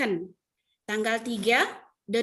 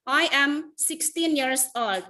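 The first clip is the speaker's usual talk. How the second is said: slow example sentence; 3 semitones higher, much slower than usual. Shown as a demonstration. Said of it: "Kalau misalnya umurnya".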